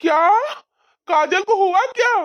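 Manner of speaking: high, urgent frightened questions; alarmed disbelief. Acted. Husband asking how the accident happened, high and alarmed. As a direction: demand to know what happened.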